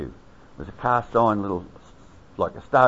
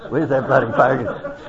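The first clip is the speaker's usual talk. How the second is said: "bloody" lifted with a rising end, louder through a laugh; laughing mock call; 4 semitones higher, 4 dB louder than usual. [name] searching the crowd for Fogarty, laughing mock call. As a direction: call it out again, amused.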